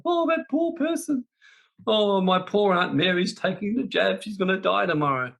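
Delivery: high, far-off whimpering pity, hammed up to mock it; mock weepy pity. Mocking people who weep over an aunt's fate, in a hammed-up high whine. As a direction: parody an overly sentimental mourner. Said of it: "sitting there crying like".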